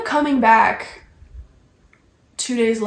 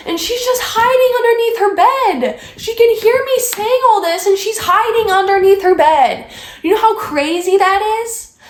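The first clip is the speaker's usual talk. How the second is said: higher, louder, exasperated disbelief; incredulous rant; 9 semitones higher, 4 dB louder than usual. Real, not acted. Venting to viewers about the roommate hiding, exasperated. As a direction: say it like you still can't believe it.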